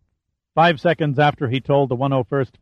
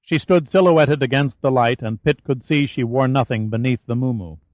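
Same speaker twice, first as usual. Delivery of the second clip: ordinary delivery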